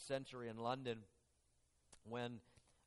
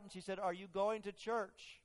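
louder, higher storytelling surge; building sermon story; 4 semitones higher, 5 dB louder than usual. Acted. Preacher driving the carriage story louder.

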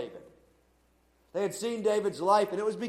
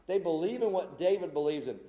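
ordinary talk

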